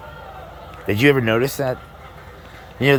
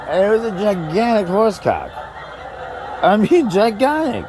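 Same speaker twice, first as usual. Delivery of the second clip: high-pitched, incredulous comic exclamation; mock amazement; 11 semitones higher than usual. Comic telling the crowd what was seen, hammed-up astonishment. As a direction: marvel at it, pitch leaping way up.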